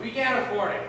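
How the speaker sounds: ordinary talk, nothing marked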